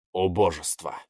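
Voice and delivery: low, scornful dragon; cold dragon voice. Acted. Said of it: "холодно произнёс дракон".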